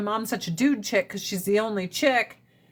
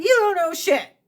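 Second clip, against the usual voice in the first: louder, higher mock parent snap; parent's blunt retort; 9 semitones higher, 5 dB louder than usual. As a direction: bark it like a fed-up parent.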